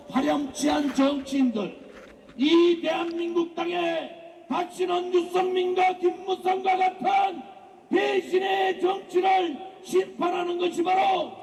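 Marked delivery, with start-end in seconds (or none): 2.4-11.3 shouted political rally cry; roaring rallying speech. Real, not acted. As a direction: rouse the crowd, voice raised high. (목청 높인 집회 연설)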